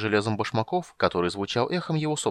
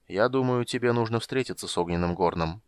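ordinary delivery

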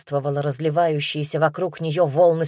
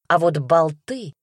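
ordinary delivery

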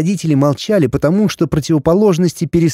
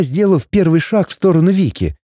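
ordinary delivery, nothing marked

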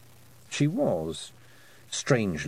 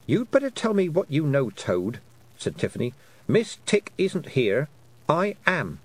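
firm insistence; 6 semitones higher than usual. Acted. Tiffany insisting the toad tell her, firm. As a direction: demand it, standing her ground.

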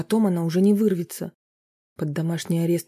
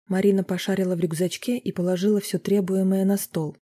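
usual speaking voice

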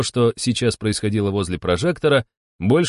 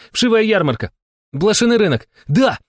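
high, loud triumphant exclamations; excited guessing; 12 semitones higher, 4 dB louder than usual. A narrator's reading. Partners racing to guess the card, excited. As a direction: shout guesses up to a jubilant yes.

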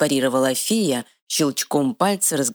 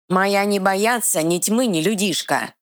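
troll growl; 6 semitones higher than usual. Acted. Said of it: "зарычал Эр".

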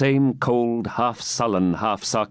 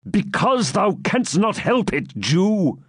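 hard commanding knight; stern knight; 10 semitones higher, slower than usual. Acted. Baron silencing the captive, stern. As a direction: shut him down sternly. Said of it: "said the knight sternly".

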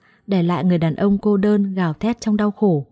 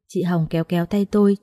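ordinary delivery, nothing marked

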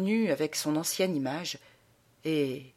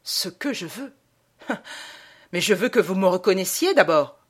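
nasty voice; 5 dB louder than usual. A narrator's reading. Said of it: "d'une voix méchante".

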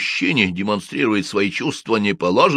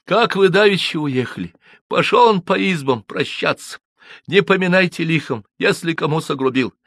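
put-on high, lively peasant girl's voice; village girl's tale; 8 semitones higher, 4 dB louder than usual. A narrator's reading. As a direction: tell the elders' decision breathlessly. Manefa retells the night. (деревенская девушка рассказывает)

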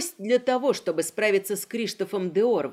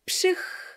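whooshing hiss. Acted. Fireball flares with a hiss.

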